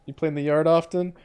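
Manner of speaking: ordinary talk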